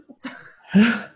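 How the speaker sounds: loud, shuddering sigh while holding back tears; tearful sigh. Real, not acted. Grieving mother trying to compose herself, heavy tearful sigh. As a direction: heave out a big breath to steady yourself. Fighting tears after naming her son.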